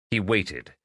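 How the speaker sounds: usual speaking voice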